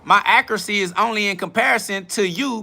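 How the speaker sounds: high-pitched, squawking comeback; exasperated, high incredulous retort; indignant protest. Real, not acted. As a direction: defend yourself with pitch shooting up. Protests criticism of his accent attempt.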